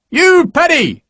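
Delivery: high, loud bellow across the yard; booming sergeant. Acted. Sergeant booming at Harper across the yard, loud high bellow. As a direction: boom it over the yard. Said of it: "Sergeant Havercamp's voice booming over the yard".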